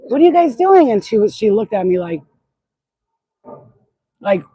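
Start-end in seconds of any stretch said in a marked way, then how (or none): 0.1-0.9 much higher, louder startled call; calling out to kids in her dream, alarmed; retold alarmed question. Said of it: "I remember saying to them".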